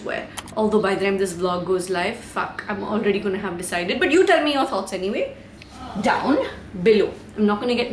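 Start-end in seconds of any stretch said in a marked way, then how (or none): none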